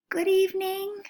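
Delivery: high, falsely cheerful possum voice; forced cheer. Acted. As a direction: fake a cheerful greeting. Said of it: "she mumbled, trying to act cheerful".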